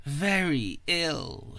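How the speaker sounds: slow, thin and frail; weak sickly old lady; frail old woman. A narrator's reading. Old sick duchess speaking.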